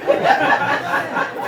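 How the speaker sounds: high, loud, airy laugh; burst of laughter. Real, not acted. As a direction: let a big laugh burst out. Laughs about having no husband.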